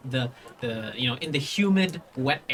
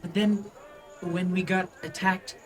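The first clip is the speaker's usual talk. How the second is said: put-on higher, halting child voice; child recounting; 4 semitones higher than usual. Child explaining the attack to the party, small halting voice. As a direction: tell it simply, pausing like a kid. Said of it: "And he looks like".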